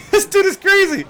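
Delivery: squeaky high laugh through the words; laughing disbelief. Real, not acted. Player laughing at the opponent's wild spam, high laughing.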